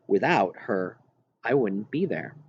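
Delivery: ordinary delivery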